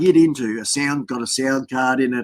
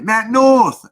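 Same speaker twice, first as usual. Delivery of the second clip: calling out greeting; 10 semitones higher, 5 dB louder than usual. Real, not acted. Greeting a viewer loudly.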